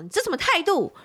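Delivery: sharp high retort, imitating a rude customer; mock angry customer. Retelling the rude customer, high indignant jab. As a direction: throw it back like the offended buyer. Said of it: "他就说什么".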